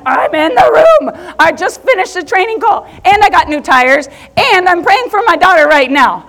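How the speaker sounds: loud, high, tearful rush of words; half laughing, half crying it out; excited protest. Retold or imitated. Mom calling from the back of the room, loud and tearful. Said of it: "I'm like".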